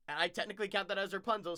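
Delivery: usual speaking voice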